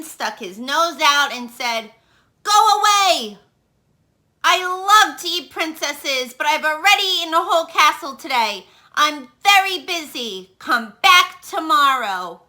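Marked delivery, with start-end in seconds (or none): 2.4-3.4 loud, high shout to go away; dragon roar. Acted. Dragon yells at princess. 4.4-12.4 a lightly voiced irritated dragon, brisk. Dragon voiced in read-aloud story.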